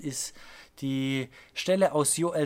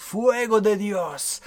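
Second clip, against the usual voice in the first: raised, drawn-out imitation of a preacher's call; imitated preacher's cry; 4 semitones higher, 4 dB louder, slower than usual. Preacher calling God's fire onto the rows, imitated cry. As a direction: call it out slowly like a revival preacher.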